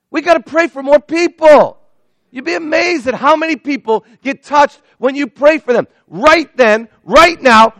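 high, loud, driving preacher's call; preaching at full force. Acted. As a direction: hammer the point home to the congregation.

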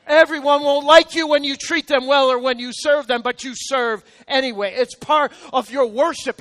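loud, high preaching cadence; preaching at full force. Real, not acted. Pastor preaching to the congregation, loud and high. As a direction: drive each point home to the crowd.

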